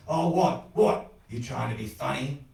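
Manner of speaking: loud, explosive cut-in; intense angry challenge. A narrator's reading. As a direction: fire it out as a threat. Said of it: "The intensity of his voice concussed".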